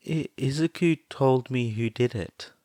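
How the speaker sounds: plain reading voice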